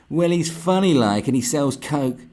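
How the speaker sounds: high, loud and slow gushing; lightly hammed-up infatuated girl; mock ditzy admirer. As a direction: gush like a starstruck girl. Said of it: "He's not going".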